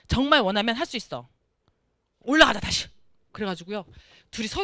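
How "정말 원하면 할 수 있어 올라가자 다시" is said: louder, rousing call to go back up; rally him: don't give up; loud encouragement. Retold or imitated. Mother urging son not to quit. (힘차게 외치는 격려)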